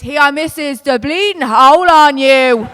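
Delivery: crude bellow, last words dragged out; mock street heckle. Irishman calling at a woman on O'Connell Street, mock shout. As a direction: holler it down the street, hammed-up. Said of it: "all I hear is".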